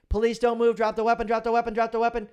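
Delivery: shouted high and loud, fast at the end; officer yelling drop the weapon; screaming police commands. Retold or imitated. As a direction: bark the police challenge at full volume. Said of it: "and I'm screaming".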